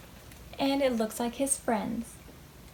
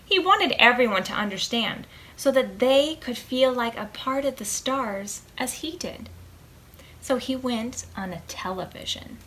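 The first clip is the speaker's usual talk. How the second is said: plain reading voice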